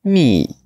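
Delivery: "mi" as a sharply falling Mandarin fourth tone. Shown as a demonstration. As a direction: start "mi" high and drop it right down. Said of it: "Et le quatrième ton".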